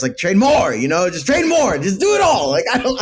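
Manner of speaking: frustrated fan shouting at his team; exasperated shout. Real, not acted. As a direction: yell it at the front office.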